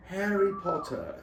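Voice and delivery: high-pitched mock British accent; theatrical British exclamation. Shown as a demonstration. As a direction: put on an exaggerated British accent, pitched well up. Attempting accents, British character name.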